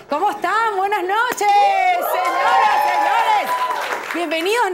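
high, shouted show-opening welcome; shouted greeting. Real, not acted. Host greeting the studio audience, shouted welcome. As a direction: call out big to the crowd.

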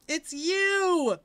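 high, loud burst of surprise; surprised shout. Real, not acted. Startled cry on spotting someone. Sudden exclaimed recognition.

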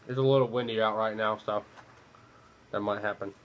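talking around pipe stem; pipe in mouth. Real, not acted. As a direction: lips clamped on pipe. Seen 1: Pipe held at lips early, stem clamped in mouth from 1.1s.

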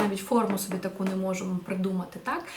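usual speaking voice

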